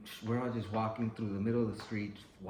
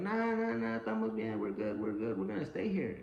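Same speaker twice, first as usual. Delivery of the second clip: relaxed dismissive neighbor. Retold or imitated. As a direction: wave it away like nothing's wrong. Neighbors brush off the warning.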